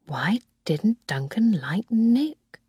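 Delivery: slow, breathy whisper to herself; whispered thought. A narrator's reading. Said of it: "I thought".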